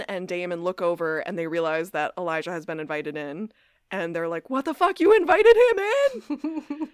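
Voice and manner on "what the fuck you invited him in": high, loud, slowed disbelief; hammed-up outraged "you did what"; shocked outrage. Brothers finding Elijah invited inside, hammed-up outrage.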